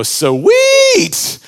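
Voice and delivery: high, loud gleeful shout; wicked brothers gloating. Retold or imitated. Preacher mocking greedy brothers, gleeful shouting. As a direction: ham up greedy glee at free loot. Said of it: "they would go".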